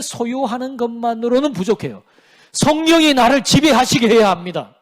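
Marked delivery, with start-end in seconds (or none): 2.5-4.8 louder, higher, forceful declaration; raised-voice exhortation; emphatic preaching. Real, not acted. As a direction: drive "성령이 나를 지배하시게" home loudly. Preacher drives home the key point.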